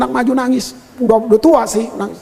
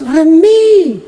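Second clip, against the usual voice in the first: louder, drawn-out cry of a name; retold surprised recognition; 3 semitones higher, 4 dB louder than usual. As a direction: exclaim the name in sudden recognition. Recognizing old friend in retold story.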